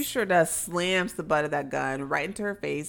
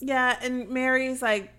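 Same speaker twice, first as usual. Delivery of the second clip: ordinary talk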